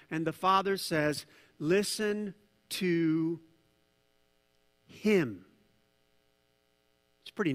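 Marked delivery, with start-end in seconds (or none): 1.6-5.5 very slow, with a long pause before "him"; deliberate command. Acted. Preacher quoting the Father to the congregation, slow deliberate command. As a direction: stretch it out word by word, hold before "him".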